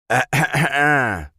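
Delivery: long high anguished scream; pained scream. A narrator's reading. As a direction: cry out as the arrow strikes. Enemy hit by arrow.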